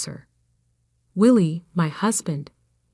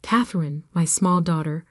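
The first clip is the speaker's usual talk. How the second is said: ordinary delivery, nothing marked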